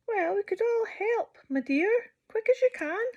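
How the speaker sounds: high, bright-pitched animal friend; friend's voice. Acted. Narrator voicing a friend offering help. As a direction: lift into a chirpy friend voice.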